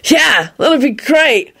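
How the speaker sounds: high-pitched cheerful holler; carefree high call-out. A narrator's reading. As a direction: call down to someone below, pitched right up. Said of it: "he hollered down at Lupin".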